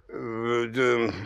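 halting, stumbling start, then blurted; nervous stutter before admitting it; koktavě, s váháním; stammering. A narrator's reading. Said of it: "koktal mladý cihlář".

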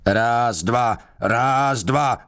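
high, barked marching count; guard yelling a march count at the prisoner; bellowed count. Acted. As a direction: drill him round the cell. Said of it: "řval na něho".

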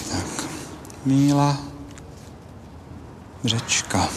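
low, halting, name in separate words; signing aloud. Acted. Headmaster signs the statement, low slow murmur to himself. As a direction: say your name slowly as you write it. A teď to podepíšu.

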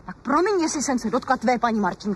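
put-on the young wife flaring up in a quarrel; rising quarrel. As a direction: snap back, wounded and indignant. Newlyweds' quarrel flares up.

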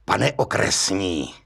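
low, slow vengeful address; vindictive. A narrator's reading. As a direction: savour the revenge, drawing it out. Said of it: "řekl mstivě".

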